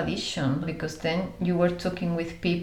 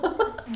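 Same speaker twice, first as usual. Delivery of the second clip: high laugh. Real, not acted. Laughs at own confusion.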